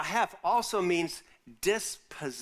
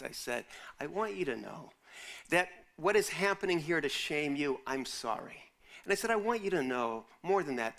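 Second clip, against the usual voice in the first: much softer, hushed comfort, almost a whisper at times; soft pastoral reassurance; tender and soft; 2 semitones lower, 4 dB quieter than usual. Retold or imitated. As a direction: comfort them gently and sincerely. Tender words to shamed women.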